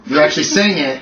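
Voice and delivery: ordinary delivery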